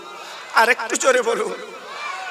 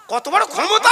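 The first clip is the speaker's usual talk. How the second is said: high, rising shout of awe; exclaiming; 8 semitones higher, 7 dB louder than usual. Real, not acted. Preacher marvelling at such power, high exclamation. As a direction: cry it out to rouse the crowd.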